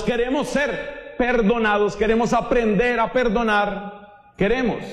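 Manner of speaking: anáfora predicada, más aguda; predicación. Acted. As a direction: repite queremos con insistencia. Predica con fuerza creciente. (predicador insistiendo en queremos)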